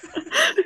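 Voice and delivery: high laughing burst; burst of laughter. Real, not acted. Laughing at Northern Quest.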